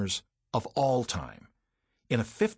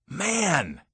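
loud, high burst of joy; exploding with excitement; 7 semitones higher, 4 dB louder than usual. Retold or imitated. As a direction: blurt it out, overjoyed. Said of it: "I exploded".

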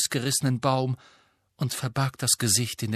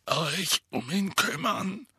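croaky voice; 8 semitones higher, slower than usual. Acted. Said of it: "mit krächzender Stimme".

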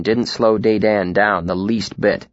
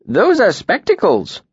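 put-on old tinker, higher and louder; a cheerful, lively tinker; 10 semitones higher, 4 dB louder than usual. A narrator's reading.

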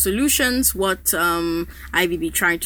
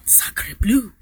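put-on French accent; 2 semitones higher than usual. Mock French outrage at oil remark.

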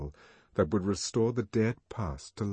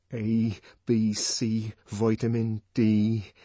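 slow, steady chant; slow incantation; 3 dB louder, slower than usual. A narrator's reading. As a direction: hold on to the spell. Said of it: "he tried to think of those times".

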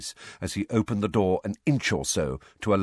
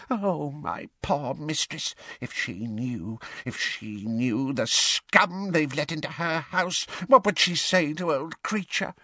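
hoarse muttering elf; 8 semitones higher than usual. A narrator's reading. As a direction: mutter and wail like an old house elf. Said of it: "muttering under its breath in a hoarse, deep voice like a bullfrog's".

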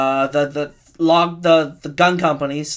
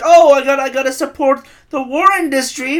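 louder and much higher, a smug arms dealer's put-on voice; mock gun maker; 10 semitones higher, 8 dB louder than usual. Gun maker justifying the war, hammed-up smug voice. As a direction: play the greedy gun maker, pumped and phony. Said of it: "The dude who made the gun is like".

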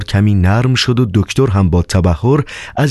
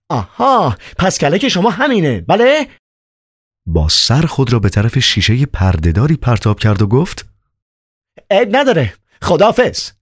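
put-on much higher, agitated young man; Ivan's voice; 9 semitones higher than usual. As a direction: play Ivan, high and worked up. Ivan, wild, cornered.

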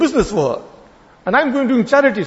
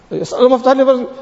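gabbled fast and higher, no thought in it; rushed routine dua; 6 semitones higher, much faster than usual. Shown as a demonstration. Worshipper rattling off the dua while greeting a friend, hurried. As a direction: toss it off quickly between greetings. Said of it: "So one is to ask him like that".

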